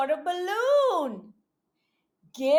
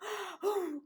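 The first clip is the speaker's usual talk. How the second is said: gasp; 4 semitones higher, 13 dB quieter than usual. Acted. Suspense before page turn.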